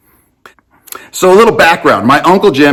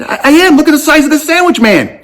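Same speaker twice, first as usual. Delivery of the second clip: much higher and fast, exasperated; exasperated insistence; 13 semitones higher, faster than usual. Retold or imitated. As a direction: plead your case in disbelief. Nephew insists, now exasperated.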